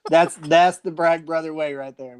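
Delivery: normal talking voice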